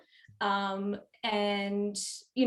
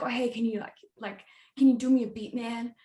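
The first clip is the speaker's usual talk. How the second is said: a clumsy mock New York rapper accent; bad American accent; 3 semitones higher, 5 dB louder than usual. Composer mocks New York rappers in a bad American accent. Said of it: "the worst American accent".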